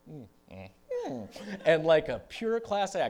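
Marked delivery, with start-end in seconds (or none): 0.1-1.3 wordless mm through a full mouth; closed-mouth humming. Retold or imitated. Musician holding vodka without swallowing, closed-mouth mms. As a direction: hum with lips sealed, holding it in. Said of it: "I went like this".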